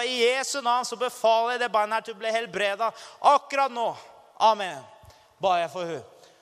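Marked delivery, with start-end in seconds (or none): 0.0-4.8 loud, high command of healing; rising to a shout on akkurat nå; commanding prayer. Retold or imitated. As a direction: declare it with full authority. Commanding healing in Jesus' name.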